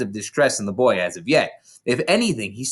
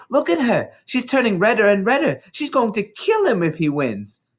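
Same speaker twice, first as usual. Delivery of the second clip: excited whisper; 10 semitones higher than usual. A narrator's reading. Matilda excited aside.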